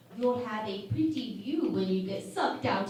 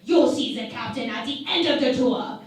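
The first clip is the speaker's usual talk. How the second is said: firm loud brush-off; 2 semitones higher, 9 dB louder, faster than usual. Acted. Commander brushes off demanding ambassador.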